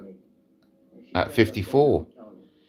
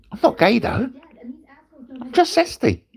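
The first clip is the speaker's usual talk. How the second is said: mock camp, pitched way up; camp zesty voice. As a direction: sing it out like a flamboyant tease. Camp joke about being zesty.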